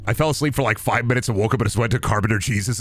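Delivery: normal talking voice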